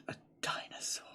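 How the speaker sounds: hushed gasp of awe; gasping whisper. Real, not acted. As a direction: breathe it out in wonder.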